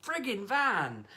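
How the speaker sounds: furious rant at a business with a hotmail email address; exasperated rant. Real, not acted. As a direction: yell it at the van owner in disbelief. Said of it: "already at nine out of ten anger".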